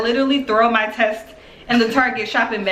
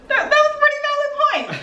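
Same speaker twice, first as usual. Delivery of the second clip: very high, fast mock squeak; hammed-up high concession with a laugh; overwhelmed admission; much faster than usual. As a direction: stammer it out in a squeaky voice. Retold overwhelmed reaction after call.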